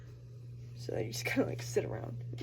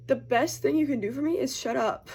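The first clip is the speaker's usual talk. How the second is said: higher and louder, laughing through it; laughing quote; 5 semitones higher, 5 dB louder than usual. Retold or imitated. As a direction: laugh as you deliver the punchline. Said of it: "he said".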